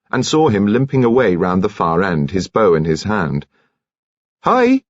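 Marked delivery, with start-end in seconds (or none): 4.4-4.8 bright, loud greeting called much higher; called out brightly. Acted. Nurse hails the commander from afar, bright and loud. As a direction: nurse calls out cheerily to the commander. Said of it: "she called out brightly".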